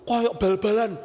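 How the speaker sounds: ordinary talk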